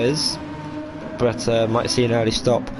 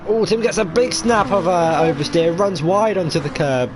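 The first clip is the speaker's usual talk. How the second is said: excited exclamation; 6 semitones higher than usual. Real, not acted. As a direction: yelp out the sudden oversteer. Sudden slide called live.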